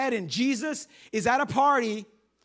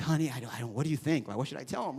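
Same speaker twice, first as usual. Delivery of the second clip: hushed aside to wife; 9 semitones lower, 6 dB quieter, faster than usual. Pretend. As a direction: lower and much softer, covering the phone. Aside to wife, covering phone.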